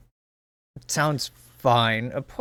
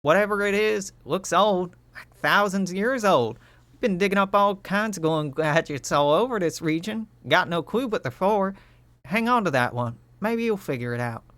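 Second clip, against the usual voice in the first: higher, bright cheerful character voice; Utopia's voice; 6 semitones higher than usual. Acted. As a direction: praise them like a proud teammate. Utopia voiced in game scene.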